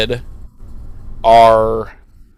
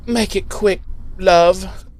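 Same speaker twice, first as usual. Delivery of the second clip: higher, light British-tinged plea; a hint of a resigned vampire; Spike voice; 5 semitones higher, slower than usual. Spike opening his shirt for Buffy, lightly voiced plea. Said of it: "he's like".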